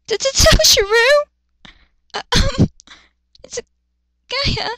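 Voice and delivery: very high, slow stuttering squeak; flustered stammer. Acted. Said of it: "flustered stammering girl".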